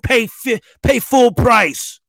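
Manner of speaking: pop-up insulting the user, mock angry. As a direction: spit it out like a sore loser. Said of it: "It's like".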